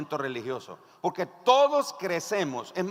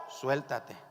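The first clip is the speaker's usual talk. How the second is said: low, softer command; calm, deep instruction from above; God; 4 semitones lower, 4 dB quieter than usual. Acted. God telling the hanging man what to do, imposing. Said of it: "Dios con su voz imponente".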